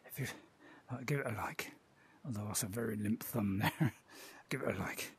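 soft whispered request; whispering. Acted. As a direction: whisper the call to like. Whispered call to like.

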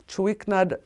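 normal talking voice

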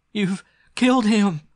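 high, loud, drawn-out wail; hysterical sobbing. Acted. Girl accusing her captor of murder, sobbing hysterically. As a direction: cry it out slowly, shrieking with grief. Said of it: "the girl sobbed hysterically".

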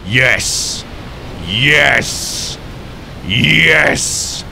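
yeses rising in pitch; building triumph. Real, not acted. Player celebrating his smart plan, building triumph.

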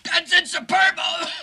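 shouted ad pitch. Pretend. Fake cigarette commercial.

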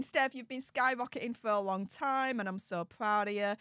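ordinary delivery

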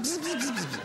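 high buzzing alarm noise. Pretend. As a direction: buzz like a warning light. (hohes Alarmsummen)

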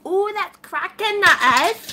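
lightly done Welsh lilt, slow; a hint of Welsh, drawn out; Welsh accent. Shown as a demonstration. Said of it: "Can you do a Welsh accent?".

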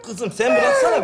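high-pitched protest over wailing; exasperated yell. Acted. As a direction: yell to be let go. Shaking off wailing daughter.

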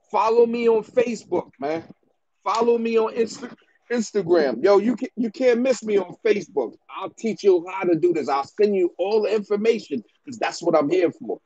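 normal talking voice

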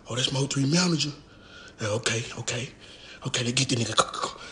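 dropped low and soft, scheming; low conspiratorial mutter. Retold or imitated. Attackers spotting the manager, low and scheming.

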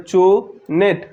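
"চুনেট" slowly as two separate beats; syllable by syllable. Shown as a demonstration. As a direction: stretch চু, pause, then নেট. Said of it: "চারবারে উচ্চারিত হচ্ছে".

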